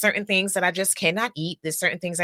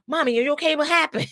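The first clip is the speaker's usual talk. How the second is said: lightly voiced son, pitched higher; retold worried son; 7 semitones higher, much faster than usual. Son checking on mother who fell, lightly imitated concern.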